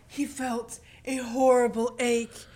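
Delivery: slow, loud, overwrought reading; hammed-up flowery novel narration, drawn out; mock literary prose. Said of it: "instead of being like".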